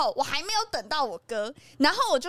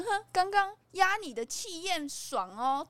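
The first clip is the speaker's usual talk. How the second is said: smug; 6 semitones higher, slower than usual. Retold or imitated. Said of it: "一副就那种拽拽样".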